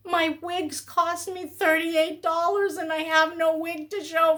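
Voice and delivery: parodying the YouTuber she refuses to be, high and whiny; mock begging YouTuber. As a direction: play the needy vlogger fishing for gifts. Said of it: "I don't want to be that YouTuber".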